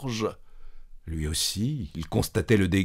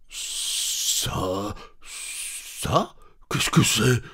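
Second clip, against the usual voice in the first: stammering; 4 dB quieter, much slower than usual. Acted.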